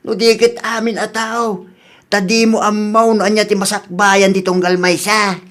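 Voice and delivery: ordinary talk, nothing marked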